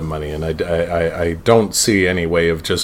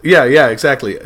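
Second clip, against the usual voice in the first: ordinary talk, nothing marked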